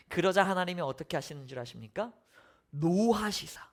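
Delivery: normal talking voice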